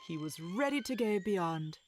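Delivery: normal talking voice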